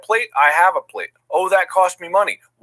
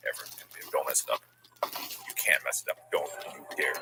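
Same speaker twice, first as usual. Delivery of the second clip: hushed whisper; 14 dB quieter, much faster than usual. Real, not acted. Hushed tense warnings.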